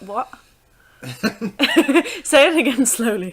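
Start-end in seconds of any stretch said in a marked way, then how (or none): 1.0-3.3 loud request through laughter; laughing. Real, not acted. Co-player teasing a convoluted description, laughing. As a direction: laugh while asking for a repeat.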